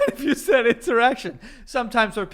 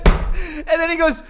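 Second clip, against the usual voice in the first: normal talking voice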